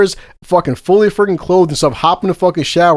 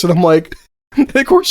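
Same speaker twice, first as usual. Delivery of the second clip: usual speaking voice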